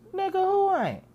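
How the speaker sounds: high indignant comeback; mock indignant. Defending himself to friends, high indignant comeback. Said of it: "And I said".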